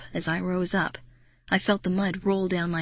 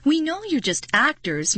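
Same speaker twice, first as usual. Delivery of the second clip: shrill blurt; 7 semitones higher than usual. Acted. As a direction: blurt out at the werewolves. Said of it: "Marty blurted out shrilly".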